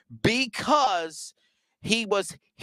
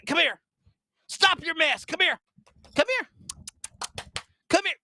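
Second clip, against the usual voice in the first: calling the dog; 9 semitones higher than usual. Real, not acted.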